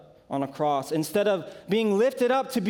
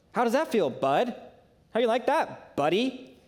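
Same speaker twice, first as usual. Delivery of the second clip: higher, gloating mock taunt; taunting little brother; 6 semitones higher than usual. Younger brother gloating after beating him, mock taunt. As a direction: rub in the win like a smug sibling. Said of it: "they would call me bud".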